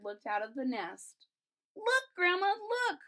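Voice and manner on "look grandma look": very high excited call; shouted. A narrator's reading. Children pointing out the bird to grandma, high call. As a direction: grab grandma's attention. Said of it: "shouted the children".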